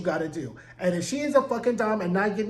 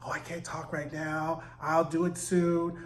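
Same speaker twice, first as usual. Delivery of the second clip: hushed, lower mock whisper; imitated whispering; 3 semitones lower, 5 dB quieter than usual. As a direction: keep it secret, low and soft. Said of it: "then you see him whispering".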